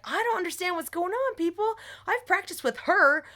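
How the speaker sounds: high-pitched, indignant retort; exasperated protest. Retold or imitated. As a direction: re-enact pleading your case to hostile teammates. Acted quarrel with the team.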